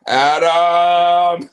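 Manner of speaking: long, loud drawn-out holler. Pretend. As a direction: bellow the name loud enough to wake the neighbors. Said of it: "Your neighbors are asleep".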